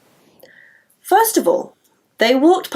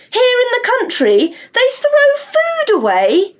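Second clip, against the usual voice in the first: put-on high girl voice; 11 semitones higher, slower than usual.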